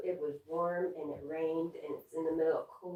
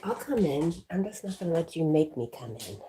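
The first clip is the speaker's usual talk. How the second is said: voicing the dog; 8 dB louder than usual. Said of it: "It's like".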